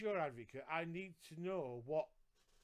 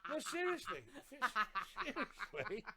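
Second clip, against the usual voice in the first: high-pitched, laughing insistence; really cracking up while protesting; 8 semitones higher, 3 dB louder, much slower than usual. Real, not acted. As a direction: insist through real laughter. Protesting through shared laughter at joke.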